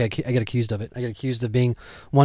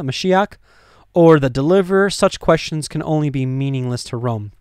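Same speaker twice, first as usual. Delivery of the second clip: dramatic scripture reading; 3 semitones higher, 4 dB louder than usual. Acted. Reads Pharisees' words.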